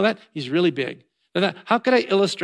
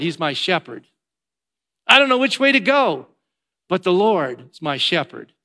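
trusting self-talk; 2 semitones higher, 4 dB louder than usual. Shown as a demonstration. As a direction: throw out the problem, then land calmly on 'but the Lord'. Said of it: "Here's how we should talk".

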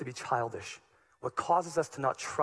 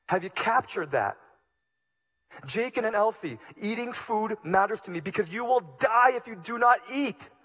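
raised, louder, higher pleading sermon voice; full-force preaching; 7 semitones higher, 6 dB louder than usual. Acted. Pastor pleading with his congregation, impassioned preaching. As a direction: preach it out with urgency to the church.

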